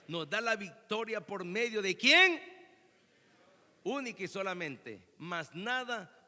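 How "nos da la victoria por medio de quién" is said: building to a loud questioning cry; loud prompting question. Real, not acted. Preacher asks congregation who gives victory, loud prompting. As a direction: throw the last word out for the crowd to answer.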